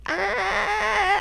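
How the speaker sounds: high drawn-out wail; mock scream. [name] reacting to being called older model, mock scream.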